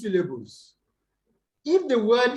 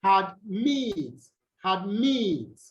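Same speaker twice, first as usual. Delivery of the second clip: adMIT, weight on a lengthened "mit"; light "ad", strong "mit"; admit, lifting and falling on the second syllable; second-syllable stress; much slower than usual. Shown as a demonstration. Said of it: "take the stress on the second".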